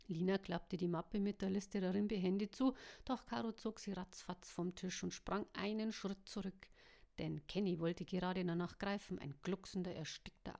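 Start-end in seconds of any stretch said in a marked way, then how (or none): none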